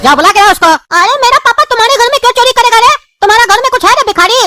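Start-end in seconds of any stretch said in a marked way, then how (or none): none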